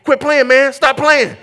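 comic outrage after a dunking; mock indignant protest. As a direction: tell a friend off, half-joking. Acts protest at boat driver.

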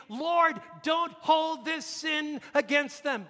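high, loud, drawn-out plea; loud crying out. Acted. Preacher voices crucified Jesus praying for mockers, loud cry. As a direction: cry slowly to heaven, every word held.

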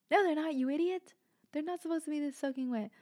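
high, loud, exasperated comeback; acted-out indignant outburst; retold angry retort. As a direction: snap back at him, pitched well up. Retelling quarrel with uncle.

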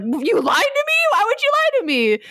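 high-pitched mock indignation; Buggy. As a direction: squawk with hammed-up betrayal. Buggy indignant at being tricked.

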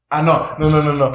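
mock panic. Retelling panic at the ghost.

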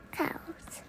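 unvoiced whispered word; just breathe the word. Shown as a demonstration. Reading word list.

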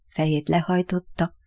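usual speaking voice

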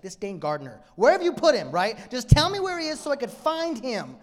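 grieving woman shouting at a gardener she thinks took the body; exasperated Mary. Retold or imitated. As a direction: snap at the gardener to hand him over. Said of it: "And she's like".

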